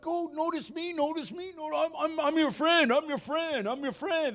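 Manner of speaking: very high, pleading puppy voice; needy, playful pet talking in breathless repeats; eager dog Nija. Acted. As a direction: beg for attention in an excited high voice. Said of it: "He's like".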